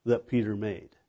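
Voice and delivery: ordinary delivery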